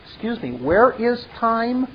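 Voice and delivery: ordinary talk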